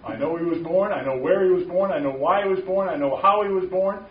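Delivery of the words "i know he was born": ordinary talk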